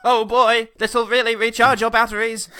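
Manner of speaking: loud, high cheesy ad read; cheesy advert voice. Pretend. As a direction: gush like a TV ad. Mock ad voice.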